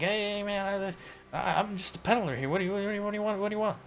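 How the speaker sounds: high, stammering, backing-off plea; a cornered addict flinching from the slammed table; scared junkie dealer. Acted. GM voices jittery dealer.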